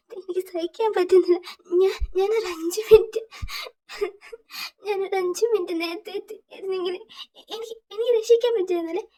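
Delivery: high, tearful pleading with sobs; grieving friend sobbing to Tae; crying. Acted. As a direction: break down and blame yourself. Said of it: "ഭയങ്കര കരയാണ്".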